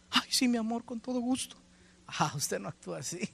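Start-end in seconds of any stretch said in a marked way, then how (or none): 0.1-1.6 high, sweetly compliant reply; mock-obedient spouse. Spouse answering a bossy order, mock-sweet and obedient. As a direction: give in with exaggerated willingness, pitched high.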